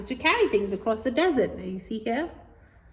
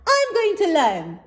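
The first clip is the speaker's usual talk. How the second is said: determined camel; 8 semitones higher, 5 dB louder than usual. Acted. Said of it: "said Calamity".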